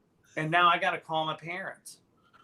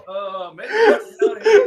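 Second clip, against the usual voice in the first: uneasy buyer; 2 semitones higher, 12 dB louder than usual. Retold or imitated. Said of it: "the people are like".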